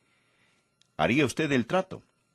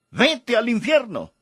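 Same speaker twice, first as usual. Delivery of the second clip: high-pitched shout, acting out an angry man; acted shout; 9 semitones higher, 3 dB louder than usual. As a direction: cry out the curse as a retold yell. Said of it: "y le gritó".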